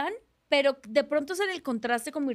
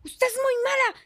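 high-pitched scolding señora; señora indignada; 12 semitones higher than usual. Retold or imitated. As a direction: imitate an outraged fan telling someone off. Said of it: "pasa una señora y me mira". (señora regañona, voz aguda)

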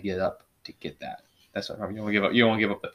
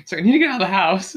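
high, loud retold plea; hammed-up need to escape, voice pitched up; mock pleading; 10 semitones higher, 7 dB louder, faster than usual. Friend retelling his text asking to go out, high mock plea.

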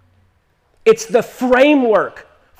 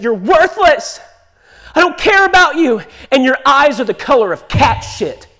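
louder, higher angry rant; raging drunk father; 5 semitones higher, 6 dB louder than usual. Retold or imitated. Raging alcoholic father voiced in story.

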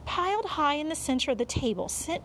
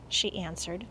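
ordinary delivery

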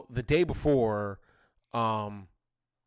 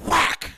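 sudden loud slap sound effect; imitated slap; 7 dB louder than usual. Dad hears slap behind him, imitated whack. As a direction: punch it out like a smack. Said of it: "sounded like it made".

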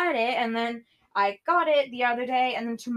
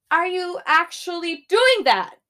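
raised, louder, exasperated; exasperated outburst; 6 semitones higher, 6 dB louder than usual. Real, not acted. Talking to camera, fed up: loud exasperated outburst. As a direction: snap it out in disbelief. Ranting at someone.

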